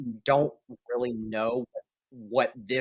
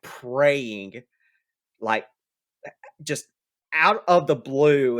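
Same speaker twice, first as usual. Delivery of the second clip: ordinary delivery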